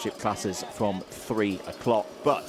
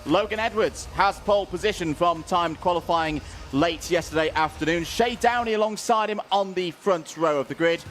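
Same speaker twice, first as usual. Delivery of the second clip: high, projected grid call; race announcer; 8 semitones higher than usual. Acted. Commentator reads the grid for the Bambino Cup, projected announcer voice. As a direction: call the starting line-up with announcer energy.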